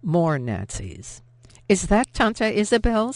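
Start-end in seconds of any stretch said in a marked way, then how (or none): 1.7-3.1 a lightly voiced little girl, higher; a hint of a child's excited question; young Sophie. A narrator's reading. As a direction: ask like an eager child spotting her aunt. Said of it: "Sophie asked".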